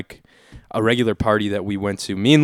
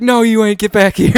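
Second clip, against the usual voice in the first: high, insistent retold call; imitated calling back; 9 semitones higher, 4 dB louder than usual. Buddy calling him back for the bus, high mock order.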